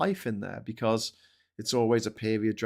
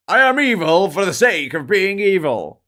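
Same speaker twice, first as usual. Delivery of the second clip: loud, higher hammy evil voice; cartoon villain; 6 semitones higher, 9 dB louder than usual. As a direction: ham up a pantomime baddie.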